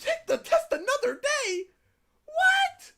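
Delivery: very high, laughing incredulous shout; laughing disbelief. Real, not acted. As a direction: cackle it in disbelief. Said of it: "y'all got it easy".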